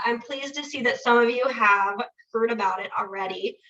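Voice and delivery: ordinary delivery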